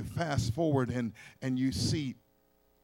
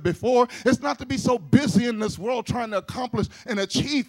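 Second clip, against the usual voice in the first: loud, climbing preacher's cry; fiery preaching; 5 semitones higher, 5 dB louder than usual. Acted. Preacher rousing the congregation about God's love, loud and fervent. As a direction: build the sermon to a rousing peak.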